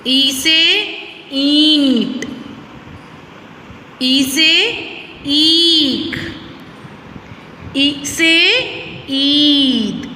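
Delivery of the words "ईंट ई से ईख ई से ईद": ईंट, ईख, ईद with a long, forceful ई; stressed long ई. Shown as a demonstration. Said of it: "ई पे हमें जोर डालना पड़ रहा है". (बच्चों को ई पर जोर समझाते हुए)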